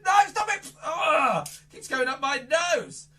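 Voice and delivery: high loud no, stop it; hammed-up squeal at the wand; mock protest. Magician to the wand, high mock yelling protest. Wand poking his nose.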